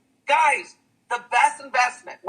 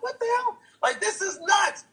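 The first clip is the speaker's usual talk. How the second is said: high-pitched astonished reaction, softer in level; amazed disbelief; 9 semitones higher, 4 dB quieter than usual. Retold or imitated. Onlooker amazed at the crowd's reaction, high disbelief. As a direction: retell being stunned, pitch way up.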